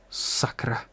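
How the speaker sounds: hushed, voiceless swear; stifled and softly whispered; muffled curse. A narrator's reading. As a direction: let the curse out under the breath. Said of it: "vypustil zdušeně Torat".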